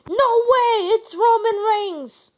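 high, loud disbelieving yell, slowing down on the name; surprised shout. Real, not acted. Player reacting to Roman Reigns' surprise arrival, loud, high and amazed. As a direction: shout it like you can't believe who just walked out. Another wrestler appears mid-match.

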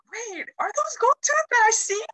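high-pitched, astonished question; a retold squeal of surprise; amazed disbelief. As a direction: ask it like you can't believe your eyes. Retold amazement at gold teeth.

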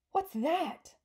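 high, loud startled question; storybook girl's startled yelp; alarmed cry. A narrator's reading. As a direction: cry out at a sudden scare. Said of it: "Sophie cried".

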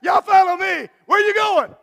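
loud, high, shouted question; crowd calling out. Acted. Churchgoers at the beach calling after the pastor, loud. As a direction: yell it across the beach.

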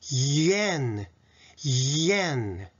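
ゆえん blended as one drawn-out "yen"; English-style yen. Shown as a demonstration. Teacher blending the sounds into yen, slow and connected. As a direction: hold the Y, then glide slowly through ゆえん. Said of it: "のようになります".

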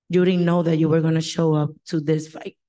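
normal talking voice

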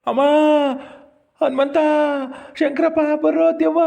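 high, long-held cry for help; terrified calling out. Acted. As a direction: wail the names out in panic. Terrified man calls companions for help.

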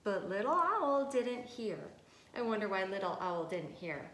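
plain reading voice

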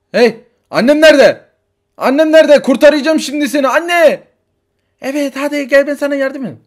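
put-on high, loud kid calling out; a desperate little boy hunting for mom; frantic child. Child Arda searching the station for his mother, frantic.

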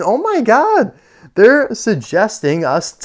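usual speaking voice

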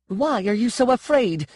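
high, angry challenge; sharp, indignant question; angry demand. A narrator's reading. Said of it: "she demanded angrily".

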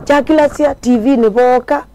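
usual speaking voice

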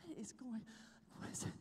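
soft puzzled whisper; confused whisper. Retold or imitated. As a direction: ask under your breath, not to interrupt. Whispering during roommate's prayer.

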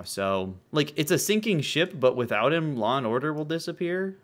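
ordinary talk

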